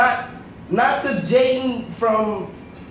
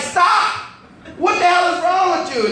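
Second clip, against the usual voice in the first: high, sharp acted yell; exasperated scolding; 8 semitones higher than usual. Uncle tells niece to stop pecking him, exasperated. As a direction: cry it out in mock outrage.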